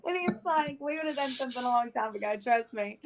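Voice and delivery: quick words through a laugh; laughing retelling. Real, not acted. Storyteller quoting the man's blunt reassurance, amused and laughing. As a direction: retell his line while cracking up.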